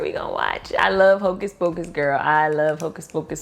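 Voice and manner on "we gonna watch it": whispered aside. Shown as a demonstration. To her girls about the movie, whispered. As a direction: drop to a breathy whisper. Repeat whispered after rise.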